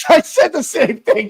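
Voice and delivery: said through a laugh. Real, not acted. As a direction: laugh while saying it.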